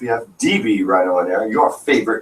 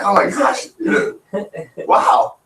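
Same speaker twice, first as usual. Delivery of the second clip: amazed; 11 semitones higher, slower than usual. Retold or imitated. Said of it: "We were like".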